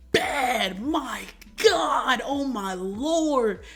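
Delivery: overcome swooning. Real, not acted. Vlogger swooning over the actress, slow high gushing. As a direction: swoon slowly, stretching each word.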